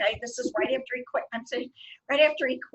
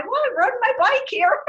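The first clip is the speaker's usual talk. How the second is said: laughing quote; 12 semitones higher, 6 dB louder than usual. Retold or imitated. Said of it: "I could not stop laughing".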